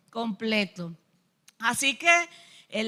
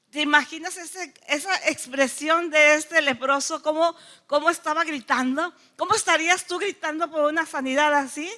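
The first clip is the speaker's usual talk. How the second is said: ordinary delivery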